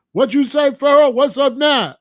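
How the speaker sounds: loud, high jeering call across the cell; mocking taunt. Cellmates jeering at the lone vice lord, loud mocking taunt. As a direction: rub it in, taunting him.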